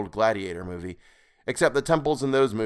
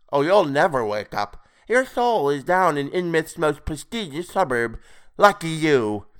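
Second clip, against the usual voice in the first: higher, louder, mocking creature voice; gloating toad creature; 6 semitones higher, 5 dB louder than usual. A narrator's reading. Creature telling his captive he is trapped, gloating. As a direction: gloat like a taunting toad-man.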